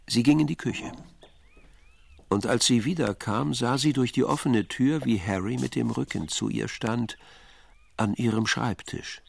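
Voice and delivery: ordinary delivery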